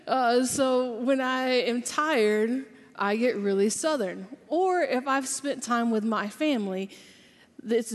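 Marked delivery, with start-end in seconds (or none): 0.1-4.3 slow, stretched Southern drawl; a tired Southerner drawling slowly. Shown as a demonstration. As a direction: let the Southern drawl come out lazy and slow. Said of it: "I have this long Southern drawl".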